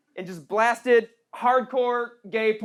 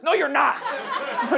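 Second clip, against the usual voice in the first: ordinary delivery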